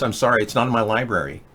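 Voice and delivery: ordinary delivery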